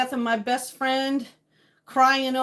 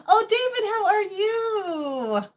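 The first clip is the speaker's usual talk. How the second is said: excited greeting; 11 semitones higher, faster than usual. Real, not acted. Spotting David in the chat, a high delighted cry. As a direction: squeal hello to a friend.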